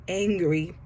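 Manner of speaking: usual speaking voice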